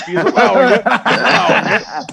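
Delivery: high, laughing delight. Real, not acted. Player cracking up at the ruling, laughing. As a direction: laugh it out in disbelief.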